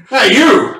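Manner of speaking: guard calling out. Acted. DM voices a snake guard.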